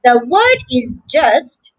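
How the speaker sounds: ordinary delivery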